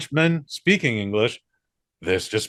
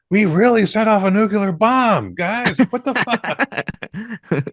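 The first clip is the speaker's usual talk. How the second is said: much higher, louder, stunned mock outburst; mock Christopher Nolan; 10 semitones higher, 4 dB louder, slower than usual. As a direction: play a shell-shocked director blurting it out. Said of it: "Christopher Nolan's just like".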